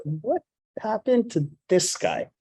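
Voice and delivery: high, slow, incredulous imitation; mock astonishment. Passengers staring at the man walking past, stunned disbelief. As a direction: stretch it out like gawking onlookers. Passengers stare at him, aghast.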